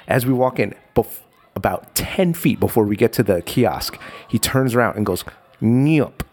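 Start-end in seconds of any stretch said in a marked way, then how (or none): 5.6-6.2 short sharp Thai "Nyup" meaning shut up; Thai shush. Retold or imitated. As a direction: clip out "Nyup" like a quick hush. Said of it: "which is Thai for "shut up".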